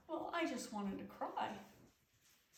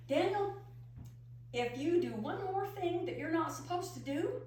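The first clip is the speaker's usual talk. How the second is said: upset scolding; 7 semitones higher than usual. Retold or imitated. Aunt warning Daniel about misbehaving, upset. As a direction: scold the boy sharply. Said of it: "I was so upset".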